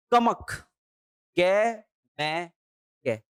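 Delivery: ordinary delivery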